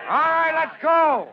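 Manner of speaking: loud, slow call to move out; rallying shout. Acted. Lieutenant calls his men to the truck, loud rallying shout. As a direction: shout the crew onto the truck.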